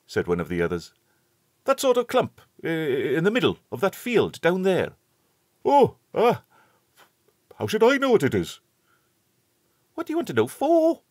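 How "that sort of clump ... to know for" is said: put-on high, quick boyish chatter; schoolboy voices. A narrator's reading. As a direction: lift the voice up as eager schoolboys. Boys arguing in the field.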